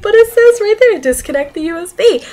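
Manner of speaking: high, loud objection easing off; insistent protest. Retold or imitated. Student pointing teacher to the instructions, protesting. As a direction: plead your case from the page.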